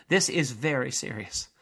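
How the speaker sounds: ordinary delivery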